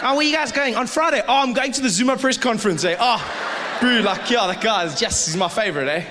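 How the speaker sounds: high, loud eager fan chatter; excited fan. Acted. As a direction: gush like a fan off to a big show. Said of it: "then you'll be like".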